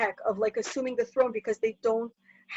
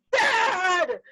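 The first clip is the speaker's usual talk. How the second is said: high drawn-out cry of "Dad!"; acted son's exasperated yell; protesting cry; 7 semitones higher, 3 dB louder than usual. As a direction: call out in protest to a father.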